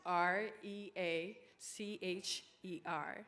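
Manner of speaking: slow, letter-by-letter spelling; spelled out slowly. Real, not acted. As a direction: spell each letter out deliberately. Spelling the misspelled name.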